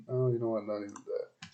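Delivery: usual speaking voice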